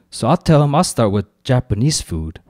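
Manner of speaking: ordinary delivery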